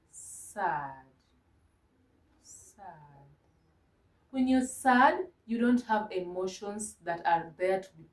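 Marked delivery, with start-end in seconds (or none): 0.1-2.8 soft, low, sinking "sad"; acted sadness, dwindling to very quiet; demonstrated sadness. Shown as a demonstration. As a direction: let the word droop and fade away. Acting out the word sad.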